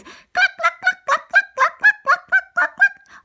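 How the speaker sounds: high loud cluck cluck; repeated high clucking; high hen clucks. Acted. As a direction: cluck like hens. Chickens clucking.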